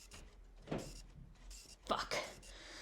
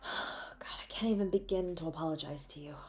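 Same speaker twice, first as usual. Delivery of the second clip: fast, hushed, lower whisper; guilty whisper to the partner; remorseful whisper; 5 semitones lower, 10 dB quieter than usual. Acted. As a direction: whisper the apology in a rush. Apologizing at hospital bedside.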